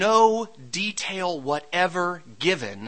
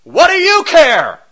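loud, higher, resentful shouting; mock angry outcry; 8 semitones higher, 12 dB louder than usual. Daniel shouting up at the king who put him there, mock angry. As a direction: yell it up as a bitter accusation. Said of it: "Daniel doesn't cry out from the den".

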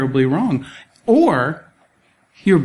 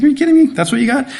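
mock incredulous Jesus; amused disbelief; 9 semitones higher, much faster than usual. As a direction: tease them with a chuckle in the voice. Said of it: "I wonder if he's chuckling while he's like".

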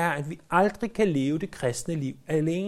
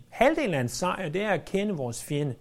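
ordinary talk

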